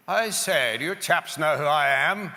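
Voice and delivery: high, loud, plummy officer's indignation; pompous British admiral. Retold or imitated. Joke's admiral rebuking two lost Aussie diggers, pompous and loud. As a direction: puff up like an offended admiral. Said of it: "it's an admiral of the British fleet".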